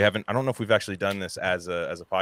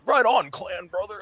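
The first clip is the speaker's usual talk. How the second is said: slow, high surfer dude drawl; 11 semitones higher than usual. Retold or imitated. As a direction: stretch it out like a surfer bro.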